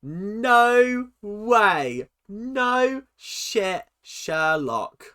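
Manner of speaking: loud, drawn-out sarcastic disbelief; mock disbelief. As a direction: lay on the sarcasm, loud and stretched. Sarcastic reaction to the clip.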